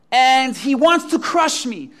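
slow, high, loud disbelief; loud incredulity. Acted. Imagined Peter checks Satan's plan, loudly aghast. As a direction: spell it out, hammed-up and aghast.

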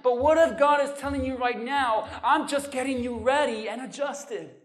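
loud, driving sermon climax, fast in places; preaching at full force. Acted.

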